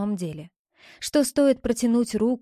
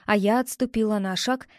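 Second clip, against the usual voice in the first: ordinary delivery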